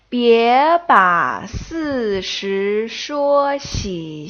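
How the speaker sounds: very slow, drawn-out Mandarin tongue twister; slow read-along. Shown as a demonstration. Teacher reading a tongue twister for learners to follow, very slow read-along. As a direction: stretch every syllable and tone for learners to follow. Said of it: "first read along with me".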